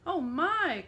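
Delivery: high, loud gasp of fright; startled cry. A narrator's reading. Tiny old woman startled by the lion, high cry. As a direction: squeal it in surprise. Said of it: "cried the tiny old woman".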